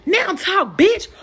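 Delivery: high yelled threat at the end; rising mock yell; mock shout. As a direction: snap it like a brawler. Retold fight, aggressor's words.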